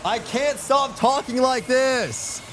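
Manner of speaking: very high, slow country-accent moan. Real, not acted. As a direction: wail it slowly in a country accent. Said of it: "talk like in this country accent".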